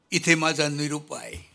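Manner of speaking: ordinary talk, nothing marked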